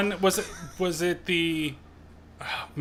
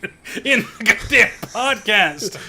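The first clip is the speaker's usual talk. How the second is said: loud, higher, through laughter; laughing exasperation; 5 semitones higher, 5 dB louder than usual. Real, not acted. Arguing with co-host over who listened, laughing exasperation. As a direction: laugh while insisting loudly. Teasing argument while laughing.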